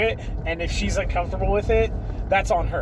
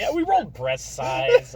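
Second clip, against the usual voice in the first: higher mocking repeat of the line; mocking imitation; 6 semitones higher, slower than usual. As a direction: parrot it back, teasing. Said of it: "I love that you just said it".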